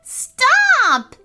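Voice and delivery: high, loud shout to halt everyone. Acted. Sister overwhelmed by talking objects, high shout. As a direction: shout over the chatter. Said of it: "shouted Ellie".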